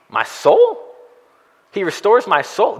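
high, baffled "soul?" rising; incredulous questioning. Shown as a demonstration. Pastor mock-questioning David's odd phrase, high and baffled. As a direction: sound puzzled, as if David changed topic.

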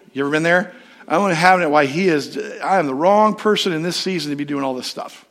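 normal talking voice

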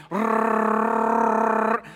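long buzzing rolled Rrrr; heavy rolled r. Shown as a demonstration. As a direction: trill the R like rumble strips. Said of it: "Can y'all roll your R's like that?".

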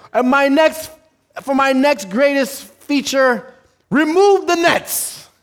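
high, loud, drawn-out stunt announcement; showman announcing. Acted. Tightrope walker announces next feat to crowd, grand showman. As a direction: play the daredevil working the crowd.

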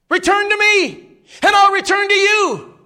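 high, loud, slow pulpit cry; mock pulpit thunder; preacher's thundering call. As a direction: boom it out like a preacher calling the pew.

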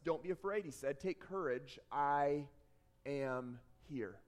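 low, soft, measured comfort; steady low voice, settling softly; calm reassurance. Acted. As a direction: reassure them quietly, pausing near the end. Jesus reassuring the frightened disciples.

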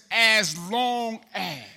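"as long as" drawn out slowly, each word weighted; stressing as long as. Shown as a demonstration. Preacher points out "as long as" to Tyrese, slow. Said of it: "There's a word in there".

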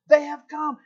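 high, loud cry of joy; excited shout. Retold or imitated. Prisoners learning they will get out, shouting. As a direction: shout the news out. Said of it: "they start shouting".

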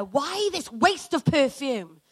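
loud, high-pitched indignant protest; retold outrage at full preaching volume. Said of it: "they're indignantly saying to one another".

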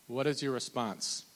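ordinary delivery

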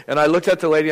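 normal talking voice

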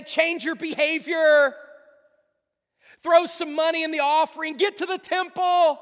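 loud, high barked orders of a preacher; mock commanding shout. Acted commands in a sermon.

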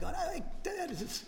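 high, slow, breathless half-gasp at the table talk; mock stunned reaction. Pastor reacting to friends talking business: mock stunned. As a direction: play it like a man at a loss for words. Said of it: "and I'm like".

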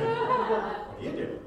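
sung very high and slow through a laugh; amused high sing-song repeat. Pretend. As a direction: sing the question back, laughing. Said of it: "It's always my favorite question".

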